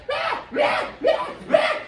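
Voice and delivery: high screeching ah ah; mock screams. Hogtied woman screaming in the cop car, high mock shrieks. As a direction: squeal like being dragged off.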